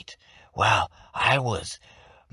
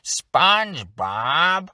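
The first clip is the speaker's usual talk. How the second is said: drawn-out nasal Squidward shout, much higher and louder; slow, high whiny yell of SpongeBob's name; cranky neighbour bellowing at SpongeBob; 7 semitones higher, 4 dB louder, much slower than usual. Retold or imitated. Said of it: "A good nasally character ... is Squidward".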